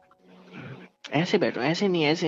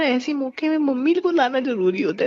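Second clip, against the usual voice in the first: mocking; 11 semitones higher, 5 dB louder than usual. Mocking her calling for mummy.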